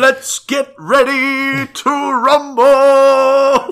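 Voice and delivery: slow, huge boxing-ring intro; ring announcer. Pretend. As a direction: belt it out like a wrestling MC hyping the crowd.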